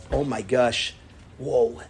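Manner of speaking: low, subdued amazement; understated sympathetic awe; showing understanding. Shown as a demonstration. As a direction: react with quiet, low empathy. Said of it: "you show understanding".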